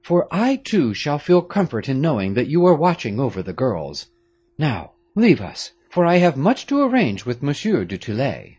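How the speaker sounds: a grave father giving orders, a touch of urgency; Marquis, grave father. A narrator's reading.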